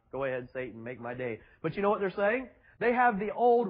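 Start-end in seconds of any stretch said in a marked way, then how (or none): 0.1-1.4 mock tough-guy line. Preacher mocking Jesus as a movie tough guy, low and soft. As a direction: growl it quietly, daring him. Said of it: "I can't imagine him saying".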